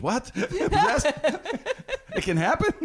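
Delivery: high, loud startled squeal with a laugh; shocked disbelief. Retold or imitated. Shocked kid in retold story.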